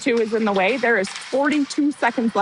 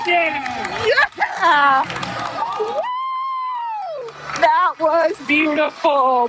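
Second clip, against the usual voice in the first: much higher, cheering the goal; goal celebration; 6 semitones higher, much slower than usual. Real, not acted. Commentator celebrating the home goal with listeners, high excited cheer. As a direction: whoop and cheer like your team just scored. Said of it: "I'm too worked up".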